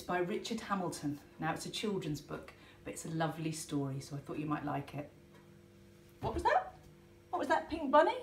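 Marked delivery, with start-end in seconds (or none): 6.2-8.2 high, puzzled asking; turning in surprise to the bunny puppet; startled query. Acted. Host asks Pink Bunny what it said, startled.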